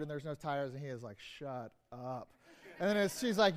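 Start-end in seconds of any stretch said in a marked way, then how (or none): 1.2-2.3 low, much softer "Shut up."; a groaning owner who finds his car robbed; dejected disbelief. Retold or imitated. Robbed car owner finds no tires, low deflated groan. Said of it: "two different stories where they have the same words".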